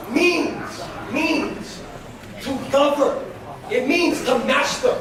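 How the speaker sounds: ordinary delivery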